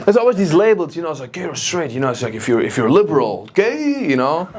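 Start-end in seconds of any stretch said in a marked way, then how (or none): 3.5-4.1 mock label. Mock label shouted again.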